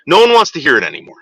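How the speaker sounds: usual speaking voice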